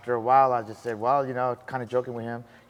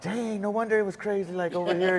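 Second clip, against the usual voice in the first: high, amazed dang; wide-eyed realization, mildly acted; 9 semitones higher than usual. Telling his crew why the area was rough, in a high amazed voice. Retold amazed reaction in Compton.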